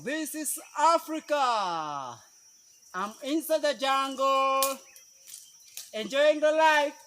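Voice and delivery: high, slow call out across the jungle; echo call. Real, not acted. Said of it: "Can you hear the echo?".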